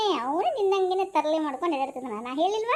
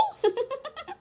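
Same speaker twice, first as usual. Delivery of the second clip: high delighted giggle; boy giggling at the aunt's invitation; happy giggle; 8 semitones higher, 3 dB quieter than usual. Acted. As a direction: giggle with relief.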